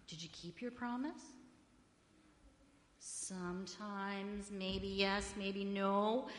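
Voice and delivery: normal talking voice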